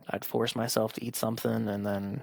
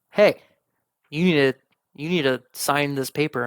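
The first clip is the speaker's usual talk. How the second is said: demanding seller; 3 semitones higher, 6 dB louder than usual. Retold or imitated. The seller phoning him, loudly demanding he sign. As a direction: bark it like an insistent seller.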